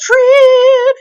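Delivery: very high, loud sustained cry; long, shrieked show announcement; segment title holler. Acted. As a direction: belt out the segment name like a jingle. Jingle-like segment title call.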